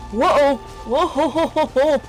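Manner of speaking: normal talking voice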